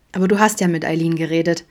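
plain reading voice

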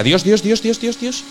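mock alarm. Vacuum seems about to take off.

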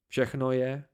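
ordinary delivery

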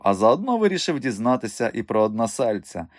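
ordinary delivery, nothing marked

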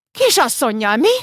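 contemptuous mocking echo of kisasszonnyal; spat out. A narrator's reading. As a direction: sneer the word kisasszonnyal back at him. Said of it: "Feri szinte köpte a szót".

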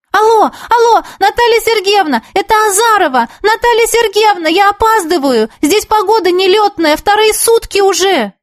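high-pitched, urgent pleading down the line; agitated phone call. Acted. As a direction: call anxiously over a bad long-distance connection. Urgent long-distance phone call.

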